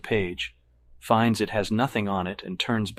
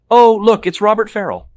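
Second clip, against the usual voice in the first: loud, high-pitched exclamation; excited; 13 semitones higher, 14 dB louder than usual. A narrator's reading. Woman spotting an acquaintance across the restaurant, excited. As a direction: squeal it out on spotting someone you know.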